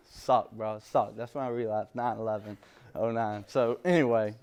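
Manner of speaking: normal talking voice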